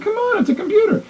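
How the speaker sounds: retelling a squeaky, disbelieving objection to the programmer; incredulous protest. Retold or imitated. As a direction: pitch jumps way up in disbelief. Exasperated retold argument with programmer.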